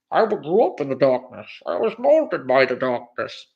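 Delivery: put-on villain voice, slowing at the end; Bane. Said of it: "I make the joke about Bane".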